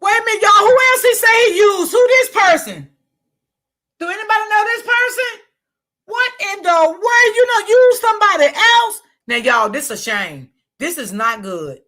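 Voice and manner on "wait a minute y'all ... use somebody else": much higher and louder, stunned disbelief; shocked outcry. Real, not acted. Vlogger shocked at what the man said in his video, loud and high. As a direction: cry out in shock to the viewers.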